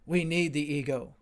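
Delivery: lightly voiced scold pleading for the ego; mock critic insisting. Critics telling the teacher the ego is needed, insistent.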